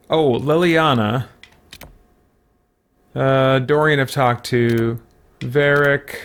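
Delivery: ordinary talk, nothing marked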